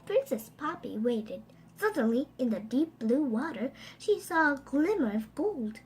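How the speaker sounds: usual speaking voice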